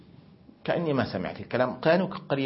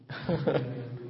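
amused laugh; 7 dB quieter than usual. Real, not acted. Narrator laughs at daughter's reply.

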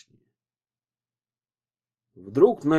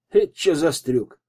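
louder, higher thug's challenge; mildly raised voice of a cocky thug; rough exclamation; 4 semitones higher, 6 dB louder than usual. A narrator's reading. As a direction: exclaim with insolent surprise. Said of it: "воскликнул первый с косой чёлкой".

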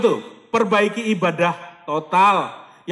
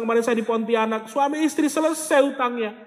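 normal talking voice

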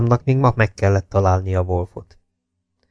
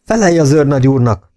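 raised, higher commanding voice; narrated yell; 5 semitones higher, 4 dB louder than usual. A narrator's reading. Jakes orders the prisoner to answer the major, a moderate yell. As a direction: bark the order without a full scream. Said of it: "ordította Jakes".

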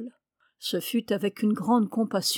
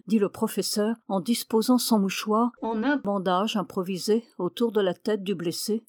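ordinary delivery, nothing marked

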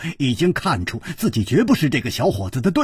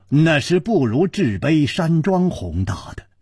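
ordinary delivery

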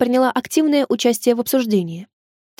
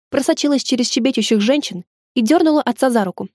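ordinary delivery, nothing marked

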